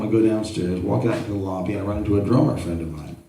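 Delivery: ordinary talk, nothing marked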